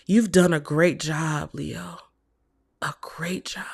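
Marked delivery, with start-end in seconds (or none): 2.8-3.6 soft unvoiced whisper of praise. Real, not acted. Whispering praise to Leo after a reading. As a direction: breathe it gently like a secret.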